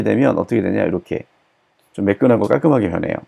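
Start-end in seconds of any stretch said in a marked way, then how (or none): none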